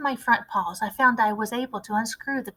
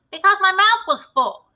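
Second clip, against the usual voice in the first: put-on higher, louder boy voice with a full mouth; greedy boy Bruno; 9 semitones higher, 7 dB louder than usual. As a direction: answer through a mouthful, cheeky. Greedy boy Bruno answers, eating.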